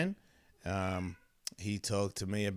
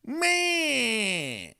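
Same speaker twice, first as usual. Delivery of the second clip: long, high falling meow; drawn-out mock meow; 10 semitones higher than usual. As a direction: stretch the meow out like a cat. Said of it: "and then he's like".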